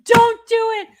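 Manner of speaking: high, loud warning shout; Don't do it! shouted way up high; yelling at the screen. Retold or imitated. Streamer warns the heroine off the basement, shrill-high loud shout. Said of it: "yelling at the prote".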